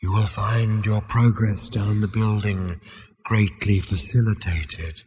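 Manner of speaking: slow, drawn-out ghostly guidance; airy, unhurried spectral voice; ethereal disembodied voice. A narrator's reading. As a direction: intone it like a calm spirit. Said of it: "the voice lifted".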